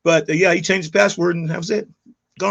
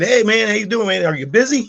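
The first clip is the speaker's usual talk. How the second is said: breezy fake-friendly check-in, a bit louder; feigned casual; 5 semitones higher, 5 dB louder than usual. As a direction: sound innocent, like nothing is wrong. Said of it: "I was acting like I was just home".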